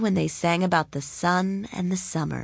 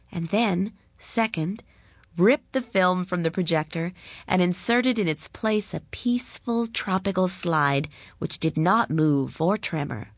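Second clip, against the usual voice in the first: ordinary delivery, nothing marked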